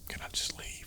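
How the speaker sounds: breathy whisper, no voice. Retold or imitated. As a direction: whisper it like you want to escape. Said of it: "And I'm like".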